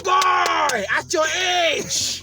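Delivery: loud, high mirror hype yell; hype shout. Retold or imitated. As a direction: shout praise at yourself, dragged out. Hyping himself in the mirror.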